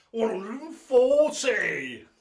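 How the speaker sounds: slow, loud, high caller call; scoring his own throw, caller voice; darts caller call. Acted. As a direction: draw it out like the MC. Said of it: "the one that I do when I hit one".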